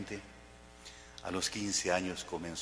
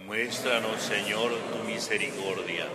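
congregation response; 3 dB louder than usual. Real, not acted. Assembly answers the prayer together.

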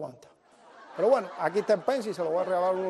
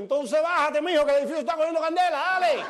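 high, loud, fast yell; urgent shouting; 8 semitones higher, 5 dB louder, faster than usual. Retold or imitated. Friend telling rooftop man to flee the fire, shouting urgently. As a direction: rush the warning up to the roof.

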